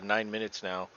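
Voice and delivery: ordinary talk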